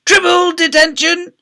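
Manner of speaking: high, shouted escalating tirade; ranting rampage. A narrator's reading. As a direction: bellow each punishment bigger and madder. Deputy head escalates into a rampage.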